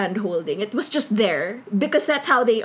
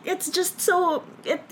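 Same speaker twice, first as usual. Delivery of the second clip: ordinary delivery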